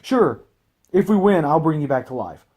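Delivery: mock carefree agreement, high and loud; imitating a too-eager yes; Goku. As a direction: pitch it up loud, then ease down toward normal. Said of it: "Goku is just like".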